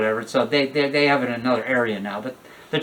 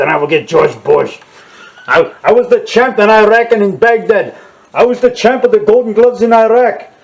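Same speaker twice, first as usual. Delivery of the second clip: Saddam Hussein impression; 3 semitones higher, 7 dB louder than usual. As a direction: bellow like a bragging dictator-champ in a mask. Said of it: "my Saddam Hussein mask over here".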